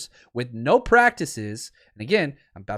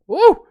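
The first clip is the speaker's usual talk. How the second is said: very high, loud "woo" blowing off steam; worked-up whoop; 10 dB louder than usual. Real, not acted. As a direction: shout to let the anger out. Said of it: "y'all got me mad now".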